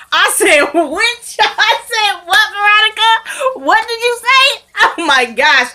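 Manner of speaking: high, incredulous and cracking up; can't believe it, laughing helplessly; laughing disbelief. Real, not acted. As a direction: squeal it through real laughter. Cracking up at Veronica's outrageous line.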